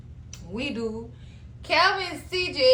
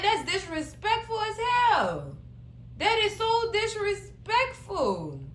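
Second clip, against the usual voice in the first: higher, slow that is so disrespectful; slow indignant disbelief, higher; outraged; 7 semitones higher, slower than usual. Real, not acted. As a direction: stretch out the outrage, pitched higher. Outraged commentary on scene.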